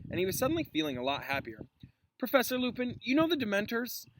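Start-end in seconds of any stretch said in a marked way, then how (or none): 2.2-4.1 Harry asking. Acted. Harry voiced eagerly.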